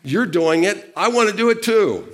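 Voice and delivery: high, eager acted plea; Peter, eager. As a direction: build from a bright call to a loud, high finish. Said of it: "I love Peter. He's like".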